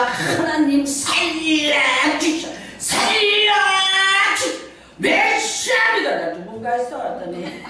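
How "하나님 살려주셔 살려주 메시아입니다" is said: very slow, climbing much higher into a half-sung shout; desperate wailing prayer. Retold or imitated. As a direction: wail to God for your life, rising to a cry. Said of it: "난리치는 소리가 나". (점점 높아지며 울부짖는 기도)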